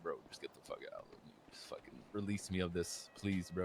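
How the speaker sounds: retold under-the-breath begging, low and soft; low, muted, fed-up pleading; weary plea. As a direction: beg quietly to be let out of it.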